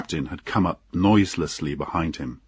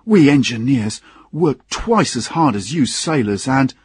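higher-pitched tipsy complaint; drunken engineer; 6 semitones higher, 4 dB louder than usual. Acted. Tipsy engineer complaining to the sailors about his hard work, slurred. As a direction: grumble like a drunk with a grievance. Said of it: "did not seem able to speak clearly".

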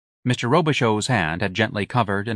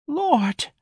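very high exclamation of pity; high-pitched, overwhelmed lament; overcome. A narrator's reading. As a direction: cry it out, overcome. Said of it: "overcome by old lady Lopez's biography".

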